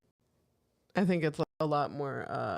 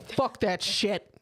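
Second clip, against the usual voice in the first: mock angry man; 4 semitones higher, 4 dB louder, slower than usual. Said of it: "two grown men get mad".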